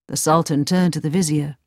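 ordinary delivery, nothing marked

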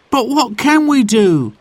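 put-on high, eager boy's voice; child voice. A narrator's reading. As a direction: voice Jack as a young boy. Child Jack voice.

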